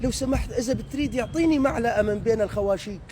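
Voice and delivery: a mock Deiri trying to sound Damascene; imitating a Deiri faking Damascene. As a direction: put on a clumsy Damascene accent over a Deiri one. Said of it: "اسمع الديري شو يقول". (ديري يقلّد اللهجة الشامية بتصنّع)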